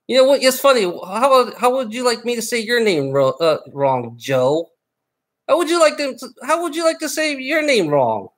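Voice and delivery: angry rant. Real, not acted. Furious rant at a chat viewer.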